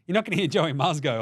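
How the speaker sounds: usual speaking voice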